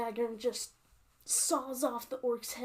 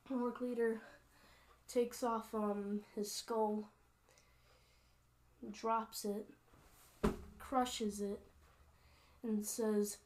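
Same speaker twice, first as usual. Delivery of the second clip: ordinary delivery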